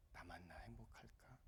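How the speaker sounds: slow unvoiced whisper. Real, not acted. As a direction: whisper the question to yourself. Pastor asking himself quietly.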